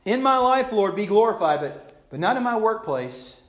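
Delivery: high, loud praise, then an excuse; mock devotion. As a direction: praise boldly, then plead an exception. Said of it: "we sing it half-heartedly".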